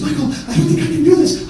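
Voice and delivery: high-pitched, fretful voice; panicky wife. Retold or imitated. Wife afraid to jump into the car, high and panicky. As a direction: imitate her losing her nerve.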